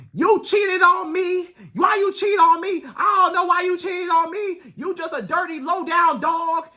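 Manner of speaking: high-pitched mock yell at a cheating man; loud accusing woman. Girlfriend yelling at her cheating man, mock shouting. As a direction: play the furious girlfriend shouting at him. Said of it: "You get loud with this man".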